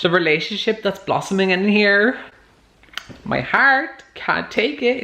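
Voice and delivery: swooning over the book, voice soaring high; excited gushing. Real, not acted. Gushing about a romance book.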